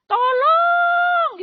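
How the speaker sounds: sudden high yell of "Tolong!", loud; high shout for help. Retold or imitated. Patient yelling for help in hospital, high and loud. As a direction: cry out for help as the oxygen line falls off. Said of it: "saya teriak".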